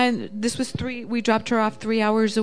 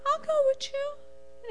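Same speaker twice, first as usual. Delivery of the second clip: high, slow squeaky imitation of the husband; teasing the husband with a small, meek voice; mock meek husband; 6 dB quieter than usual. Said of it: "not quite in that tone of voice".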